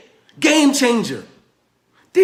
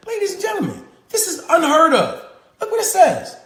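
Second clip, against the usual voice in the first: excited; 8 semitones higher than usual. Real, not acted. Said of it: "Why you think I'm so excited".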